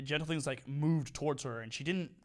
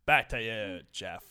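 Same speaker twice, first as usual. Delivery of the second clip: deep news anchor sign-off; 5 semitones lower, 5 dB louder, slower than usual. Acted. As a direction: hand it back like a TV anchor. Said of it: "my anchor voice".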